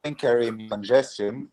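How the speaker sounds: choppy, cutting-out mic; bad connection. Real, not acted. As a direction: voice breaking up over a laggy call. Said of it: "you may wanna leave and come back".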